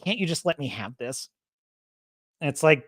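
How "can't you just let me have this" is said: low, soft mock-smug plea. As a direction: imitate a smug character, voice dropped and small. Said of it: "she's got that kind of smug".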